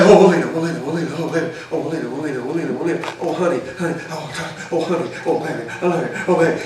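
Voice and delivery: hushed, swooning husband routine, names tumbling out over and over; hammed-up murmured devotion, low and soft; mock worked-up pleading. Husband working himself up before speaking to wife, mock pleading. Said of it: "get myself all worked up in some kind of mental attitude".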